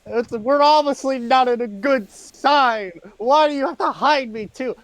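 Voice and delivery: high, loud, whiny hand voice; squeaky mage hand. Acted. Floating Mage Hand sulking about being hidden away. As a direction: sulk like an offended floating hand.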